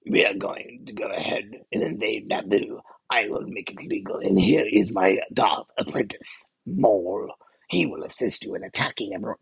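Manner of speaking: deep, raspy, hushed villain voice; Palpatine impression. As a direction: scheme low and menacing like a Sith lord. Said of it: "And he goes".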